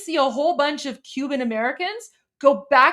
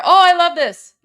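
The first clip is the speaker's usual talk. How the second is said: loud, thrilled and higher; delighted; 4 semitones higher, 10 dB louder than usual. Real, not acted. Streamer cheering footage of the party headquarters burning, loud delight. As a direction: cheer it out with real joy.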